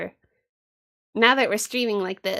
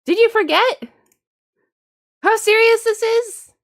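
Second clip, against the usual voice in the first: hammed-up higher, louder rebuke; mock scolding; 10 semitones higher, 6 dB louder than usual. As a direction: pretend to be outraged at the fun. Mock scolding grandpa about no fun.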